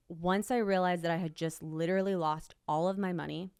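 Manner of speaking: ordinary talk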